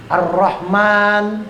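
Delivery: Ar-Rahman with a long rolled r; doubled r. Shown as a demonstration. Teacher showing the tasdid, slow doubled r in Ar-Rahman. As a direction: slowly stretch Ar-Rahman, leaning on the doubled r. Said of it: "ro-nya ada tasdidnya".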